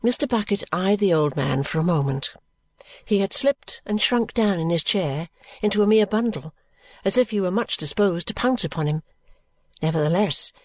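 usual speaking voice

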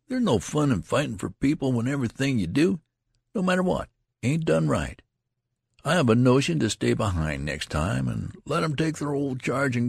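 injured rustic griping; wounded grumbling. A narrator's reading. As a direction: complain as one deeply wronged. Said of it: "deeply wounded at an injustice".

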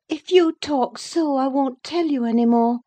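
put-on high, petulant young lady; peevish, high-voiced huff. A narrator's reading. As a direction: pitch it high, threatening to clam up. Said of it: "peevishly rising to her feet".

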